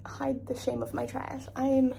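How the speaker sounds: normal talking voice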